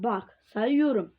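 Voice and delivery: ordinary talk, nothing marked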